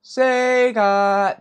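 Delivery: excited loud call; excited guess. Real, not acted. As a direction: blurt out the guess. Shouted guess.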